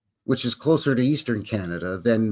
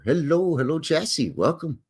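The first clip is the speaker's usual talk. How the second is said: usual speaking voice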